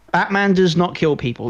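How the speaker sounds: ordinary delivery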